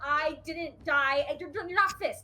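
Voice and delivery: high, worked-up, pleading scolding; exasperated, upset telling-off; laying into Pip. Acted. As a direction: lecture a reckless friend out of worry, pitch jumping up. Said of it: "Eris is still laying into Pip".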